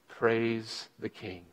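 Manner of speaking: very soft, slow and low praise. Real, not acted. Preacher quietly praising the King, slow and soft. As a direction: breathe it out slowly in awe.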